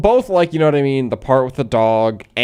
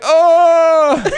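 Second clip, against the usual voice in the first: choked gasp; 6 dB louder than usual. Retold or imitated. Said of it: "and, like".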